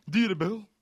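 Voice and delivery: high loud accusing cry; furious reproach; angry accusation. Acted. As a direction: spit out the insult. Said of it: "zei hij".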